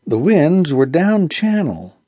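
ordinary delivery

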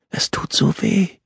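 high, whispery frail voice; pained whisper. A narrator's reading. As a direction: whisper it weakly, in pain. Injured girl whispers her pain.

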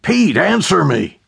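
desperate shout into phone. Acted. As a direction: call out urgently. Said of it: "Jack shouted into the phone".

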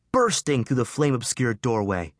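ordinary delivery

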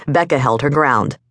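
usual speaking voice

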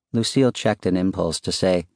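usual speaking voice

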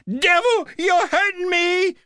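very high, wailing cry; pained scream. A narrator's reading. Woman being handcuffed by the cop, high pained scream. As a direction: shriek in pain and accusation.

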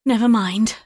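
plain reading voice

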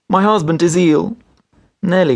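high, tearful voice held in check; restrained sobbing. A narrator's reading. Wife trying again with the doctor, restraining her sobs. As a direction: hold back the sobs as you plead. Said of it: "restraining her sobs".

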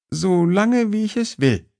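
woman asserting control over a flirt on the phone. A narrator's reading. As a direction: she takes the upper hand. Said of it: "Ihre Stimme klang jetzt souveräner".

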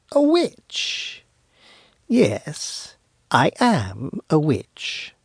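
mock the word, then savour it slowly; gloating witch. Witch owns up to the wise man, gloating.

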